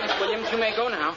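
ordinary talk, nothing marked

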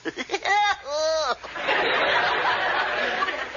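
high breathless giggle; giggling. Acted. Mel giggling at Betty's father, high giggle. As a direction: giggle like a silly idiot. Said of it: "you giggling idiot".

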